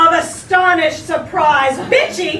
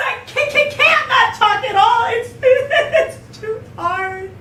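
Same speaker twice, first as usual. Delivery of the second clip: higher, stammering mock sobs; sobbing; 5 semitones higher, slower than usual. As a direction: blubber it out, hammed-up. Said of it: "she sobbed".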